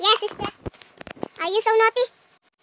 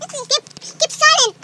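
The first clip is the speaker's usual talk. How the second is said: angry shout; 9 semitones higher, 7 dB louder, slower than usual. Real, not acted. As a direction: yell at them to hush. Child scolding noisy sister.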